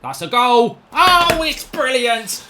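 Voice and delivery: high, loud ecstatic roar; screaming with real delight; goal celebration. Real, not acted. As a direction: erupt with joy at the goal. Goal celebration while gaming.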